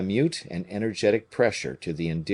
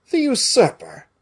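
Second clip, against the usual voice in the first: higher, louder baffled cry; puzzled exclamation; 7 dB louder than usual. A narrator's reading. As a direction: blurt it out, confused. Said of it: "said Porthos, scratching his head".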